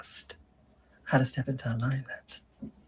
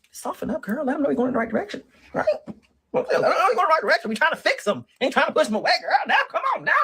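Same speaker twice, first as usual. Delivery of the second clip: much higher, loud, laughing urging; excited coaching; 12 semitones higher, 3 dB louder than usual. Real, not acted.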